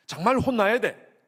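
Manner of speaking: loud, slow indignant demand; mock demand. Pastor voicing a wounded member, loud and slow. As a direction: voice the hurt one's satisfaction. Voicing the wronged person's wish.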